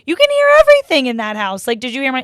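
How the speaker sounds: high, loud indignant outburst; retold anger. Upset daughter confronting family after screaming outside, mad. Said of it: "I'm just mad at my family".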